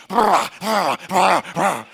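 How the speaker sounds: loud, rough flapping bursts; noisy parrot flapping. Retold or imitated. Showing how loud the parrot was, noisy flapping noise. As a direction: imitate heavy parrot wings with the mouth. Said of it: "And the parrot was like".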